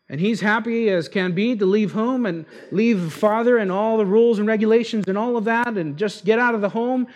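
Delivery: usual speaking voice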